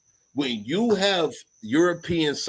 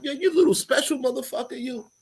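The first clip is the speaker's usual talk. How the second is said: marveling; 11 semitones higher than usual. Retold or imitated. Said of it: "I'm like".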